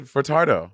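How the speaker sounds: "Furtado" with an R in the middle, fur-TAR-doh; added R. Real, not acted. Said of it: "I'm a adding an R".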